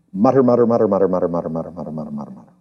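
fading to a soft low mutter; muttering to oneself. Shown as a demonstration. Preacher shows the church how meditating sounds, fading mutter. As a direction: mutter it, trailing away to nothing. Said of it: "the word meditate literally means to mutter".

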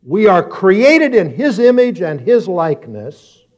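high, deliberate pulpit proclamation; emphatic sermon, key words stretched out; preaching. Real, not acted. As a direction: drive the point home to the congregation. Preaches to congregation.

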